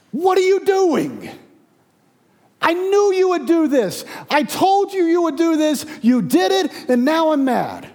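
high, loud, indignant outburst; angry. Acted. Jonah turns to God to complain, acted angry shout. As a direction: let Jonah rage up at God in a strained shout. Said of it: "turns to God angrily and says".

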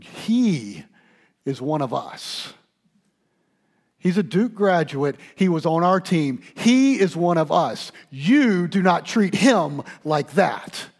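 loud, high scolding of the crowd; coach scolding. Retold or imitated. Coach K scolding student crowd, loud and stern. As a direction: lay into them like a furious coach. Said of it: "starts screaming at them".

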